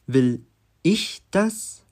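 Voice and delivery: "ich" high, loud and set apart; slow, word by word, lifting hard on "ich"; stress on ich. Shown as a demonstration. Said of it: "mit drei unterschiedlichen Betonungen".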